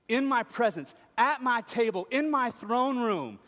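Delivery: high, loud, pleading build; impassioned preaching. Acted. Preacher voicing Jesus' longing for his children, impassioned and loud. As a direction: plead it out full force.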